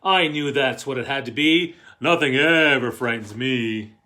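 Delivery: put-on loud, lively read-aloud to kids; a parent tucking a child in, read out loud and animated; storybook reading. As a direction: read it big for the children in the back row. Parent role in the picture book.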